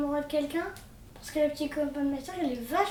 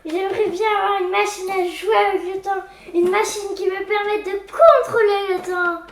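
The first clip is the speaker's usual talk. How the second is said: eager daydream; 3 semitones higher, 9 dB louder than usual. Acted. Boy daydreams aloud about time machine.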